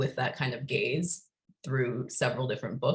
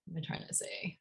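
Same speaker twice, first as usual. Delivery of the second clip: near-whispered aside while searching for words; muttering to self; 3 semitones higher, 12 dB quieter, faster than usual. Real, not acted. Moderator losing her thread mid-introduction, muttering to herself. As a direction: ask yourself under your breath, almost voiceless.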